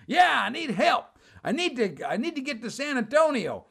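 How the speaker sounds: high, insistent pleading voice; simple stranded traveler. Retold or imitated. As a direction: imitate a confused man needing help. Voicing the odd stranded traveler.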